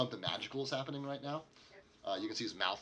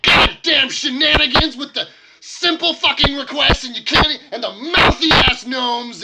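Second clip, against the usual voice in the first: high-pitched screaming rant; livid dwarf; 21 dB louder than usual. Acted. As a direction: rage at them at the top of your lungs, sputtering. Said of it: "he's just fucking, like, livid".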